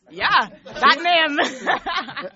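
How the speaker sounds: drawn-out yeah breaking into laughter; laughing yeah. Real, not acted. Singer amused by the host's reaction to the name, laughing. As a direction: laugh through the words.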